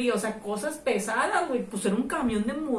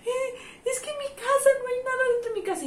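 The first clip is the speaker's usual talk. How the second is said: high wailing mimicry of the victim; distressed señora; 12 semitones higher than usual. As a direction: imitate her high-pitched distress over the robbery. Distressed woman explains empty house.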